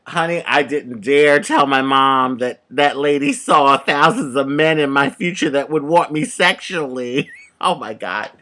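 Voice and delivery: normal talking voice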